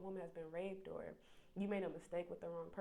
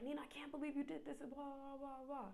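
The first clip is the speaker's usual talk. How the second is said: mock angry scream; 8 semitones higher, 3 dB quieter than usual. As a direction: mimic a friend yelling over the Xbox. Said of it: "you're screaming and you're like".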